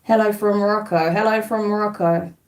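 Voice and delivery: ordinary delivery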